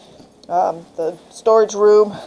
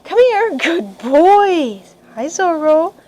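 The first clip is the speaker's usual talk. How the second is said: high, sing-song call to the puppy; cheery praise for a puppy; 6 semitones higher, much slower than usual. Shown as a demonstration. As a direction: coax the pup toward you happily. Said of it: "the, the".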